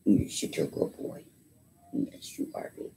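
soft unvoiced whisper soothing the dog; tender whisper. Real, not acted. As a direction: whisper comfort to a frightened pet.

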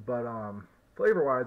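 talking right after a puff; slightly muffled smoky speech; cigar in mouth. Real, not acted. Seen 0: Hand holds cigar at lips at 0.1–0.4, lowered by 0.6.

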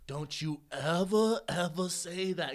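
very slow mock admonishment; Billy Madison. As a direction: scold in a slow, comic impression. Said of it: "like Billy Madison".

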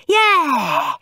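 high, loud whoop; gleeful loud yell; excited cheer. A narrator's reading. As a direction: cheer like a thrilled boy. Boy thrilled by goo toy.

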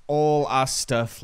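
ordinary talk

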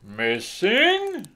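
loud, slow Santa voice; alarmed Santa. A narrator's reading. Santa reacting to the elf's news, loud and concerned. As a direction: ask in sudden concern.

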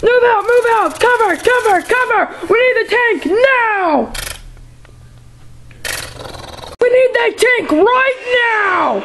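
high yelled battle orders; frantic battle shouting. Pretend. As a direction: bark orders under fire. Battle with the cucumber.

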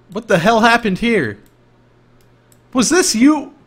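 high, loud indignant outburst; hammed-up scandalized shout; mock outrage. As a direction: demand answers in loud disbelief. Acts outrage at finding a mess.

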